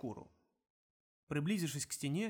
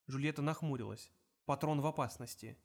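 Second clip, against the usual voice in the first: ordinary delivery, nothing marked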